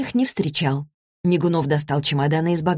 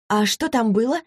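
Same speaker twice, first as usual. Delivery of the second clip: higher-pitched, eager to know; curious question; 6 semitones higher than usual. A narrator's reading. Daughter asks what was there, full of curiosity. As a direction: ask with lively curiosity. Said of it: "полюбопытствовала Василиса".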